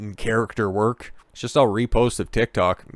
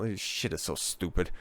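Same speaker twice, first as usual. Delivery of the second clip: usual speaking voice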